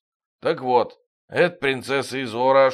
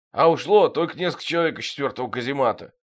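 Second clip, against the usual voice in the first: ordinary delivery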